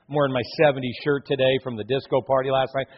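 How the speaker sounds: ordinary delivery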